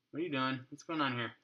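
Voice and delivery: normal talking voice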